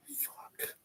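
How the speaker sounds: a frustrated curse, whispered under the breath. Real, not acted. Vlogger annoyed at forgetting a name, whispers a curse to himself. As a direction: breathe the swear out, no voice.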